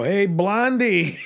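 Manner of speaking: slow, loud, high "Hey, Blondie" call; drawn-out call down the street. Retold or imitated. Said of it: "I can just go".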